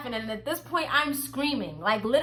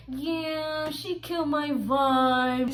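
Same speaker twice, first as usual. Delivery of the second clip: mocking whiny guy; 3 dB quieter, much slower than usual. Guy complaining to friend.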